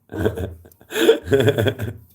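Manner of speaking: big loud laughing fit; real hearty laughter. Real, not acted. Laughing hard.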